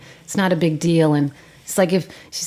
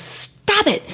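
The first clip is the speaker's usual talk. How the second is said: sharply raised pitch, scream held back; retold scream; 11 semitones higher, 5 dB quieter than usual. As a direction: imitate a screamed command, softly. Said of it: "And she's screaming".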